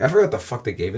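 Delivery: usual speaking voice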